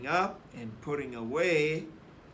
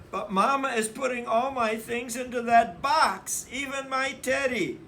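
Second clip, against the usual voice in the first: put-on much higher, louder child's complaint; whiny little girl voice; complaining sister; 8 semitones higher, 6 dB louder than usual. A narrator's reading. Sister complaining to Papa about Mama, high plaintive.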